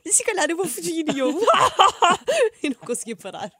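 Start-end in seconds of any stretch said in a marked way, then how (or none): 0.1-1.0 quoting him while cracking up, high; can't stop laughing mid-quote; laughing through quote. Retold or imitated. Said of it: "e ele". 1.4-2.6 loud high laughing burst; real laugh she can't hold; burst of laughter. Real, not acted.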